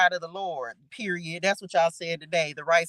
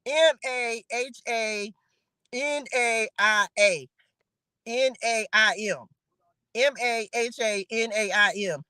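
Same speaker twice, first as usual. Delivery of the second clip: slow spelling out. Shown as a demonstration. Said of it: "spell it".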